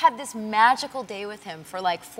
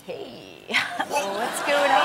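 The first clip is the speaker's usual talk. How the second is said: slow, slurred dazed greeting with a laugh; stoned daze; 2 semitones higher, much slower than usual. Retold or imitated. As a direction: drift through it, spaced out and giggly. Retelling being stoned.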